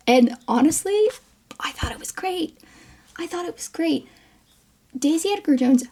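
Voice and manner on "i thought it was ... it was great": high, soft near-whisper; hushed confession. Real, not acted. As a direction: confide it quietly, pitched up. Confiding an opinion in an aside.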